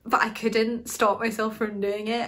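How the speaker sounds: ordinary talk, nothing marked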